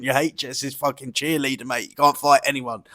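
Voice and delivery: ordinary delivery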